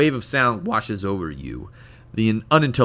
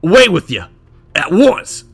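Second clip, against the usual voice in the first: raised, louder put-on voice; acted dismissal; 7 semitones higher, 9 dB louder than usual. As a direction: shoo them away, with a break before the end. Retake of the character's dismissal.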